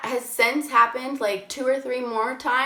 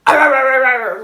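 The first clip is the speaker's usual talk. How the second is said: loud mock dog barks, much louder; evil dog bark; 3 semitones higher, 11 dB louder, faster than usual. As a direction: bark like a tiny angry dog, belting it. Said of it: "like, evil".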